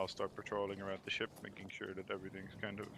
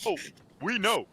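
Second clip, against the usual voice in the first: loud, high, knowing "we know"; loud knowing exclaim; 12 semitones higher, 13 dB louder than usual. Real, not acted. Players answer together knowingly.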